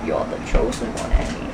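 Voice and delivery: very low whispery impression; low breathy chosen-one line; Morgan Freeman voice. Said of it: "Is it Morgan Freeman?".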